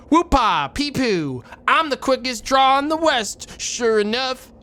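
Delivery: high loud cowboy whoop. Acted. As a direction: holler like a rowdy old gunslinger. Cowboy character Gabby voiced.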